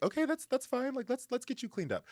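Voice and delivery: high, soothing reassurance; lightly voiced friend calming him down; reassuring friend. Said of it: "his friend's like".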